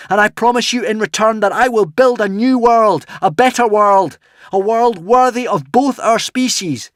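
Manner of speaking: high, loud, rising plea of trust; passionate shout. A narrator's reading. Hiccup begging the dragon to trust him, passionate loud plea. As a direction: promise a better world at full voice. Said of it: "shouted Hiccup passionately".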